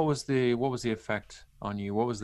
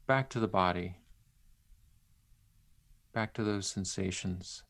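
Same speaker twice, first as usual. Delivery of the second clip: unhurried and gentle, leave long pauses; centering exercise. Acted. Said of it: "lead you through a, a brief centering exercise".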